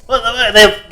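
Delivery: loud, high imitated startle; mock shocked reaction. Coworkers reacting to his Spanish, loud mock shock. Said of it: "They laugh every time".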